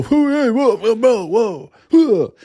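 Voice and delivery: high, loud mock hollering; mock whoops of a milling crowd; brawl whooping. Imitating players milling in a baseball brawl, high mock whooping. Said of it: "walk around and go".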